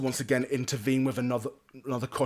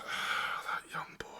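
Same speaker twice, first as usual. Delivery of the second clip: soft, breath-only sighed words; weary sigh; 9 dB quieter, slower than usual. Acted. Sir Amadeus watches the boy ride off, sighing. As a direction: sigh it out under your breath. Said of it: "just kind of sighs and goes".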